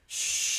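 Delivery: a loud, forceful shh breath; a firm, loud exhaled shh; strong shh. Shown as a demonstration. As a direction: blow the air out hard on shh. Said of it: "this sound dissipates liver qi stagnation".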